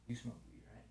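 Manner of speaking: very soft, secretive check; furtive question. Retold or imitated. Tapping the Swedish dude to ask quietly, furtive. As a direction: keep it down to a near-whisper. Furtively asking others about weed.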